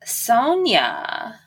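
normal talking voice

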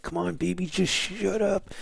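put-on exhausted dad hushing a crying baby at night; hushed plea. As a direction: plead quietly with the crying baby. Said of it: "I'm like".

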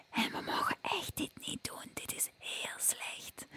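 whispering demo. Shown as a demonstration. Vocal coach demonstrating the harmful whisper to students, whispered. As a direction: whisper it to show what not to do. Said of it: "Nummertje vijf, fluisteren".

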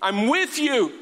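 high, emphatic divine proclamation; God calling Gideon. Acted. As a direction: proclaim it as God rallying a doubter. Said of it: "God comes on the scene and says".

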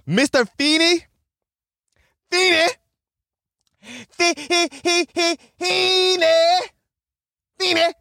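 high calling voice across the yard. Retold or imitated. Calling out for the teacher.

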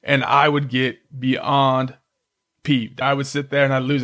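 ordinary talk

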